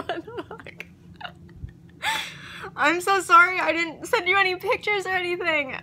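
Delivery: giddy, high-pitched excitement with laughs bubbling in; overjoyed, laughing through the words in disbelief; real excitement. Real, not acted. Telling viewers the offer got accepted, giddy and excited. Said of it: "look at how excited you are".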